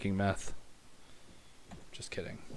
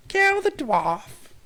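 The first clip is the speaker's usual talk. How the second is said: much higher, louder, very slow Kill the dwarf shrieked; screeched battle cry; 11 dB louder, much slower than usual. Pretend. Streamer's figure shrieking Kill the dwarf, then apologizing for eardrums. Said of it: "Sorry, rest in peace, uh, ear".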